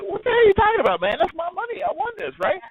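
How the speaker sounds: high, indignant what-the-hell; indignant outburst. Retold or imitated. Telling the casino manager the money is his, high and outraged. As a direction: raise the pitch in outraged disbelief. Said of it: "And I was like".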